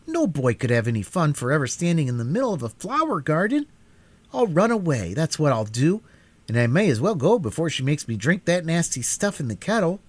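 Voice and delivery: lightly voiced boy muttering defiance; rebellious. A narrator's reading.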